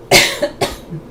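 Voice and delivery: hard dry coughing; coughing fit. Real, not acted. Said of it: "coughing fit".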